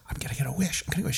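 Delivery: hushed, breathless whisper; excited whisper. Acted. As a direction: whisper it to herself in delight. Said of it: "the little girl said".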